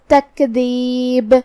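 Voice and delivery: تکذیب stopped with a bouncing ba; qalqala on ba. Shown as a demonstration. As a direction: end تکذیب on an echoing b. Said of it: "با پہ جزم سے رکیں گے".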